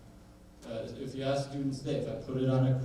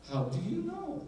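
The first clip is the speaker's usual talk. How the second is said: probing question; 4 dB quieter than usual. Real, not acted. Repeats rhetorical question to audience.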